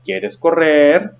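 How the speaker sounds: "¿Quieres correr?" drawn out slowly as a question; questioning intonation. Shown as a demonstration. Teacher shows how a question mark sounds on "¿Quieres correr?", questioning intonation. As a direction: ask "¿Quieres correr?" with a slow, questioning tune. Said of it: "cuando hay un signo de interrogación".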